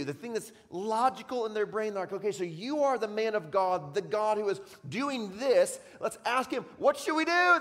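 loud, high, driving sermon storytelling; preaching at full force. Acted. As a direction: preach it big, building the story. Retells Jonah at full force.

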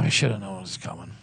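low, deflated regret; dejected. Real, not acted. Host rues the losses he should have seen, low and dejected. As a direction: sigh it out low, to yourself. Lament over losses, first take.

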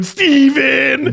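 put-on high sing-out kid taunt; giggling taunting son. Dad imitating son mocking him by name, gleeful. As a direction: call it out high and teasing. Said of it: "he starts giggling and going".

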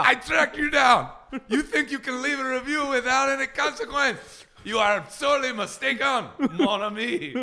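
high-pitched, hammed-up French accent; mock menace from a chef in a bad French accent; Ludo Lefebvre. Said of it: "can't do a good French".

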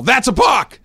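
high, loud outburst; yell. Acted. Baseball person reacting to the pitcher, yelled. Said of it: "your reaction is to yell".

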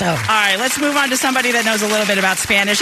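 usual speaking voice

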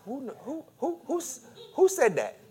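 놀란 듯 높고 작게 더듬는 목소리; startled who. Acted. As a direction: stammer it out, spooked and high. Startled man hears a voice.

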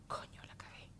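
hushed aside to oneself; regretful whisper. Retold or imitated. Mother realising she messed up after yelling, regretful whisper.

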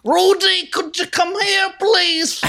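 high, loud calling Cosby voice; Bill Cosby impression. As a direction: call the kids like Cosby. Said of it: "Can you guys do a Bill Cosby impression?".

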